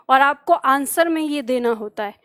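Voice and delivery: usual speaking voice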